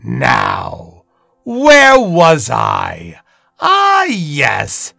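loud, drawn-out theatrical narrator; dramatic host narration. Acted. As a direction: savour the cliffhanger like a villainous host.

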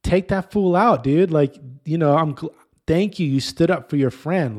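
loud, high, fired-up approval; dad secretly cheering his boy on; proud inner cheer. Retold or imitated.

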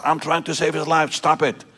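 high-pitched, urgent mock scream; retold scream. Nurse snapping at another nurse over a patient, high imitated scream. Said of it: "she screamed at her".

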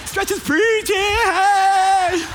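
hammed-up elderly reveler cheering shrilly upward; Sandy Dean at seventy-five. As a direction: whoop it up in a mock old-lady squeal. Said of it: "like Sandy Dean at age seventy-five being like".

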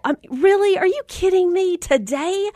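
high, incredulous are you kidding me; a mom caught off guard by surprise news; disbelief. Real, not acted. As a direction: pitch up in shocked disbelief. Shocked at surprise meet and greet.

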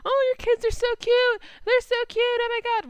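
imitating Millie cooing at Sophia's kids, high and loud. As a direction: coo over the kids like an obsessed stranger. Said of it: "she's basically harassing Sophia ... she's like".

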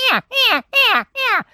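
high, loud squealing cow mew; cow elk call with voice. Shown as a demonstration. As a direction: mimic a cow elk calling, high and hard. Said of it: "I'm just my voice, but that's ... a similar sound".

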